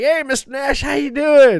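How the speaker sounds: high, loud fan's hello; eager greeting. Retold or imitated. Brother walking up to the wrestler, eager greeting. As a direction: imitate calling out brightly to a celebrity.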